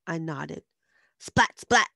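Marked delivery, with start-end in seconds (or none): 1.2-1.9 two loud, high, punchy splats; imitated splats. A narrator's reading. Narrator imitating eggs hitting a window, loud punchy splats. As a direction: make each word hit like an egg.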